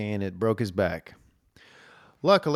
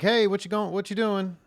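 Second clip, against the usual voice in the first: much higher, breezy hello; cheery phone greeting; 9 semitones higher than usual. Retold or imitated. Answering his buddy's wife, bright and much higher. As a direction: greet her brightly, not knowing yet. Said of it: "And I'm like".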